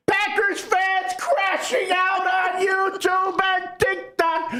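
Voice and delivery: very high, drawn-out exultant cry; gleeful gloating. Real, not acted. Bears fan relishing rival meltdowns online, gleeful high crowing. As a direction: crow it slowly in a high voice.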